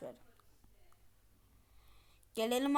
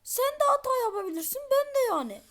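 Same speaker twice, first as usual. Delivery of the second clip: high, loud defensive sidekick voice; whiny robot voice; 12 semitones higher, 5 dB louder than usual. Pretend. Robot argues with first Fırat, high and sulky. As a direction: answer back like a sulky helper.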